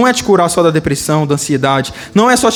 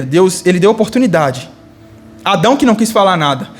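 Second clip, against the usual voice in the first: ordinary talk, nothing marked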